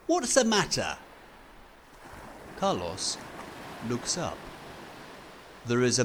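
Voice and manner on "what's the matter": high, loud cheery hello; friendly stranger. Acted. Fred greeting lonely Carlos, high cheery call. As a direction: call out brightly to a sad boy.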